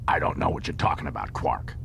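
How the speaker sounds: fast, curt dismissal; Odo voice. A narrator's reading. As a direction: brush him off quickly.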